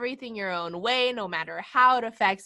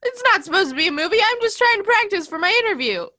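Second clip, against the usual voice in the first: higher, louder, fast exasperated complaint; fast, high, louder put-upon rant; mock angry Boimler; 10 semitones higher, 7 dB louder, faster than usual. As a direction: play an indignant Boimler, pitched up and loud. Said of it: "He was pissed off".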